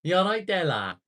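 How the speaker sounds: loud, high Scouse greeting, hammed up; exaggerated Liverpool accent, starting high and dropping on the last word; mock Scouse. Streamer mimicking a Scouser greeting someone, mock Scouse. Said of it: "It's the way they say it".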